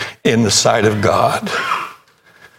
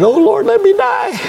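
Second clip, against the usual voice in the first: high, wailing mock lament; hammed-up cry of despair to the Lord; despairing Elijah. Elijah in the wilderness, mock despairing wail. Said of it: "and said".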